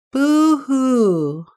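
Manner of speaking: high loud wailing boo-hoo; crying boo-hoo. A narrator's reading. Lonely beaver wailing across the pond. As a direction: wail it like a sad little beaver. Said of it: "said Little Beaver".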